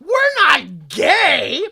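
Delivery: loud, high, slowed panicked denial; mock flustered shouting denial. Two men caught out, denying loudly and flustered. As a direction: protest way too hard. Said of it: "They go".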